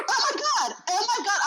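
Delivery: high-pitched, scandalized imitation of a rider; mock shocked passenger. Driver mimics rider just ordered out of the car, shocked.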